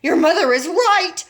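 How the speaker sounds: a father shouting backup; shouted. A narrator's reading. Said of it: "shouted Mister Beauregard".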